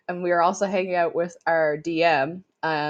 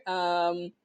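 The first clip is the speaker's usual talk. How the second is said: ordinary talk, nothing marked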